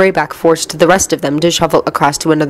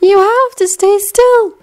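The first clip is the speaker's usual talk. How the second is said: high, slow airy voice; gentle, far-off instruction; dreamy distant voice; slower than usual. A narrator's reading. Luna's slow voiced dialogue.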